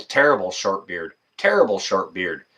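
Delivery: ordinary delivery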